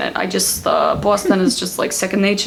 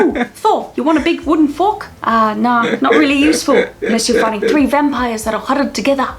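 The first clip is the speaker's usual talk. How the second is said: put-on higher New Zealand accent; offhand Kiwi banter; chatty New Zealand accent, amused; Korg; 4 semitones higher, 3 dB louder than usual. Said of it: "when I think of New Zealand is Korg".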